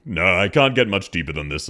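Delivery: usual speaking voice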